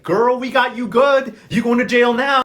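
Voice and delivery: loud, high, triumphant taunt; gloating arrest. Retold or imitated. Jordana arrests her at last, loud gloating. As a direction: crow it loudly, stretching the words out.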